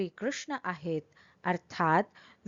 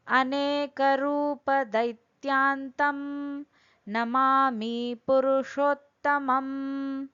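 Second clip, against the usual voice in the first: slow, higher Sanskrit chant; over enunciating; 5 semitones higher, slower than usual. Acted. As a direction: intone each syllable slowly and deliberately. Recites Sanskrit verse, then explains.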